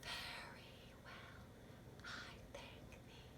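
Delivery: whispered sung reply. Shown as a demonstration. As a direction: whisper the answer for the child. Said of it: "I'll whisper it".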